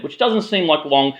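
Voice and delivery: normal talking voice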